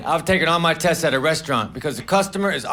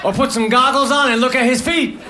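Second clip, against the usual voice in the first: higher-pitched mock exasperation; exasperated pool actors; 5 semitones higher than usual. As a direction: whine like an actor stuck in a shallow pool. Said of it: "the actors would have said".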